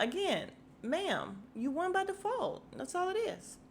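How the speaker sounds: mock-polite dismissive ma'am; sassy retort. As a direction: talk down to her like she should know better. Her own sassy retort.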